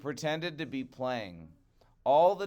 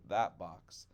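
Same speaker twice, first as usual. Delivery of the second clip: ordinary delivery, nothing marked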